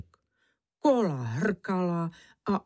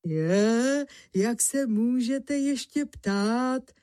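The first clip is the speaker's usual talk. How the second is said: higher, delighted exclamation; eager delight; 5 semitones higher, slower than usual. Acted. Honza to the grinder, accepting the stone, eager and delighted. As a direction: jump at the offer with delight.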